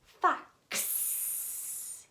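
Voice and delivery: fox with the final ks sound hissed out; fox, stressing the final ks; fox, whispered ks ending. Shown as a demonstration. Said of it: "you hear his sound at the end of a word".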